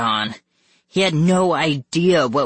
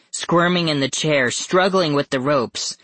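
ordinary delivery

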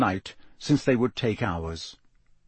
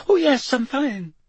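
much higher, forced reassurance; gabbling high; 14 semitones higher, 3 dB louder than usual. Acted. Neville insists he is fine, gabbling much higher. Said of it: "Neville gabbled in the same unnaturally high voice".

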